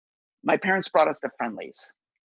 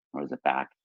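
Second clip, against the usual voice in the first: a sudden much lower, softer voice; dropped voice; 5 dB quieter, faster than usual. Retold or imitated. Joking about the boy umpire's breaking voice, a deep low drop. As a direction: let the voice fall to its deepest like it just broke. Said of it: "whose voice is changing".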